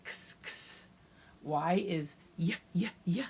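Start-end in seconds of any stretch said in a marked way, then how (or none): 0.0-0.9 "x" as a whispered ks; x sound. Shown as a demonstration. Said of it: "X is x".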